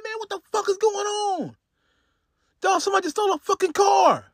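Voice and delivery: imitating a furious friend, high and rising to a fast shout; outraged yell. Friend discovering his car stolen, mock furious shout. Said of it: "He's like".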